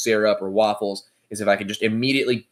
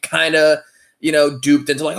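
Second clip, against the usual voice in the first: usual speaking voice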